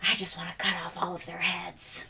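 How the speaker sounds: put-on soft, fast, creepy child's whisper; eerie little whisper of a threat. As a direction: breathe it out like a spooky kid. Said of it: "you hear a whisper saying".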